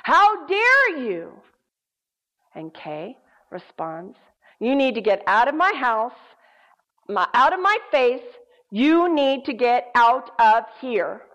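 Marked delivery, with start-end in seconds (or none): 0.0-1.4 high, booming, drawn-out demonic outrage; loud menacing voice. Retold or imitated. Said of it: "says loudly". 4.6-11.2 loud, emphatic banishing order; firm command. Retold or imitated. Kay orders the demon out, retold.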